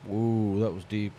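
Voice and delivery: usual speaking voice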